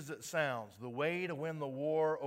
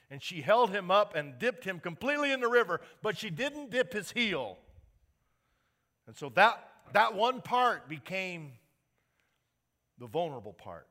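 louder, higher, slowing on the heel; preaching climax; 6 semitones higher, 10 dB louder than usual. Acted. Pastor telling the congregation about Achilles, in rising loud preaching. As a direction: build the Achilles story to a peak.

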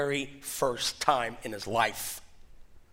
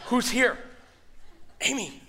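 hammed-up frightened yell waking his wife; panicked shout; 9 semitones higher than usual. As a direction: call out in a startled panic. Retells calling out in panic.

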